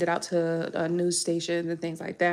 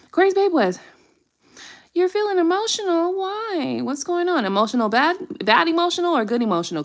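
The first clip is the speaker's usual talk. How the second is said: much higher, caring sing-song; high cooing concern; 10 semitones higher, 5 dB louder than usual. Real, not acted. Reader coos high to an emotional viewer by name.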